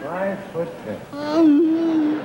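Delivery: high, slow, drawn-out disbelief; deadpan echo of the punchline; incredulous repeat. Acted. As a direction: stretch it out in dry disbelief.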